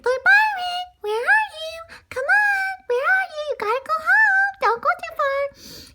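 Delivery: put-on very high, loud girl calling out; a little girl calling her runaway dog. Girl calls after lost dog.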